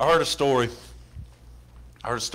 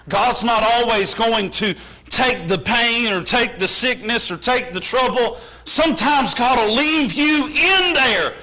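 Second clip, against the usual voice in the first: raised, high, insistent preacher's voice; full-force preaching; 9 semitones higher, 6 dB louder, slower than usual. Real, not acted. Preacher warning that God may leave trouble, full-force preaching. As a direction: build the force phrase by phrase.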